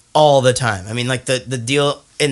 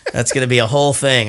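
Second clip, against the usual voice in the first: normal talking voice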